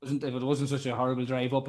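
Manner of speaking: ordinary talk, nothing marked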